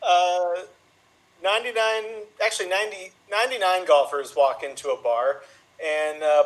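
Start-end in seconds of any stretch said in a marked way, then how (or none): none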